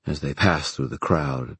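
ordinary delivery

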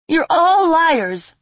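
very high, slow, loud accusation. Acted. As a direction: drag it out accusingly. Fairy accuses demons.

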